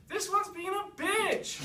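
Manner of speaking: high, slow, loud tattling yell, laughing; whiny shout. Acted. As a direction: tattle to mom at full volume. Prince complains to mom.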